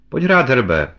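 loud call to a husband. A narrator's reading. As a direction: call him over to the card table. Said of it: "volala na něho manželka".

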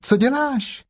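very high, small piglet voice; high-pitched little animal. Acted. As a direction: squeak it out like a tiny piglet. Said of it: "zvolalo prasátko".

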